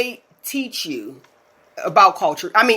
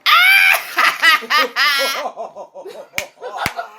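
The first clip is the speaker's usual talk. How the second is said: long burst of laughter; 6 semitones higher than usual. Real, not acted. Reacting to the friend's punchline with big laughter. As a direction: crack up fully.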